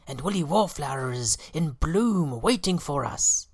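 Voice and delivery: high, loud bee scouts; reporting scout bees. A narrator's reading. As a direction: announce it eagerly. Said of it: "they reported".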